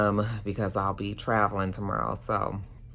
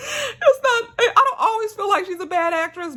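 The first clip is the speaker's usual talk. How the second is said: real giggles breaking through the words, much higher; tickled, half-laughing higher voice; 4 dB louder than usual. Real, not acted. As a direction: laugh helplessly as you try to talk. Cracking up over bad acting.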